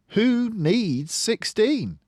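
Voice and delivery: put-on high, slow, indignant child grumble; sulky retort. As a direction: toss it off like a huffy kid. Child grumbling about counting lesson.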